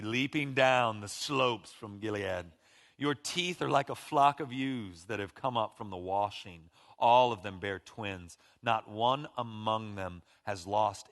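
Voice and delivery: slow, tender reading of the Song; scripture reading. Acted. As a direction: savour the verses. Reads Song of Songs aloud.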